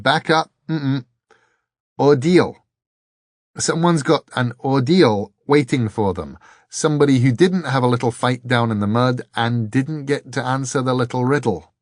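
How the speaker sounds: babbling. A narrator's reading. Richard protests the ordeal waiting for him, babbling. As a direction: tumble from word to word without caring. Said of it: "He was babbling".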